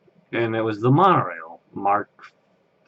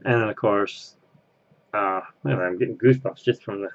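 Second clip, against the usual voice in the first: ordinary talk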